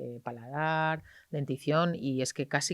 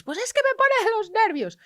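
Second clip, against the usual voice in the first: high, loud, slow exasperation; mimicking a parent at the end of her tether; exasperated parent; 10 dB louder than usual. Parent telling the doctor she loses her nerve, loud and slow. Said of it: "¿y tú qué haces?".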